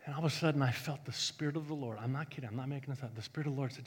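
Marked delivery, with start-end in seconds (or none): none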